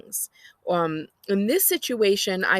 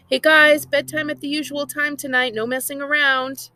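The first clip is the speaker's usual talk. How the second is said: high, loud call upstairs; 7 semitones higher, 5 dB louder than usual. A narrator's reading. Mom calling to the twins about bedtime, loud call. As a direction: call it out to the kids. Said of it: "calls to the twins".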